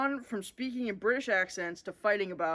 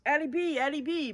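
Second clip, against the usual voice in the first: put-on a little boy thrilled to see her; excited cry; 7 semitones higher than usual. Bodhi calling out to his cousin on the platform, excited. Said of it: "Bodhi cried excitedly".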